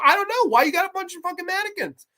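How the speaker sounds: put-on high, baffled kid yelling in disbelief; mock bewildered kid squeal; amazed Macaulay Culkin. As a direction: shriek your confusion at the booby traps. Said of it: "been like".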